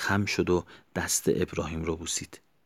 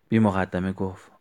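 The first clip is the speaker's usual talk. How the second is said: usual speaking voice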